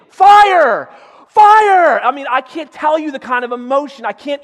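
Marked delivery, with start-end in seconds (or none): 0.1-2.0 high, loud panicked scream; screaming fire. Retold or imitated. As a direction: scream the alarm at full volume. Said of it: "I started screaming".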